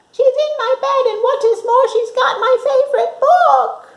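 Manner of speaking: high, loud squeaky little bear; Baby Bear. Acted. Baby Bear finding Goldilocks in bed, high and loud. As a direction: squeal it like a tiny outraged bear cub. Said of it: "and Baby Bear said".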